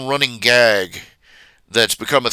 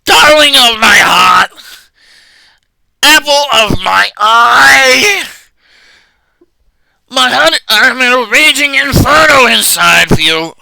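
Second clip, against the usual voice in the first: much higher, louder, slow screeching shout; Gilbert Gottfried; 11 semitones higher, 9 dB louder than usual. Retold or imitated. Fan imagining a voice actor calling Ashley, screeching Gottfried impression. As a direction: screech her name like a cartoon parrot. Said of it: "in Gilbert Gottfried's voice".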